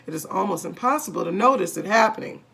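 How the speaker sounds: plain reading voice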